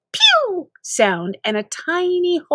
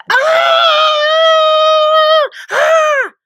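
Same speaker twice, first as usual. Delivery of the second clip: panicked scream; 8 dB louder than usual. Acted. Continued screaming.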